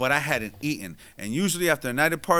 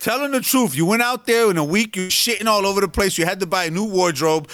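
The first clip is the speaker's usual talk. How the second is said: teasing yell; 6 semitones higher, 4 dB louder than usual. Real, not acted. Teasing co-host, raised voice.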